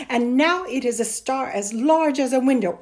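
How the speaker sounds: loud, exasperated echo; scornful disbelief. A narrator's reading. Mother repeats son's tall tale, disbelieving. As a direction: throw the boy's claim back at him. Mother throws his words back.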